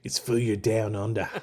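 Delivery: menacing mock Aussie accent; criminal-sounding Australian accent. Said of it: "my Australian accent sounds like a criminal".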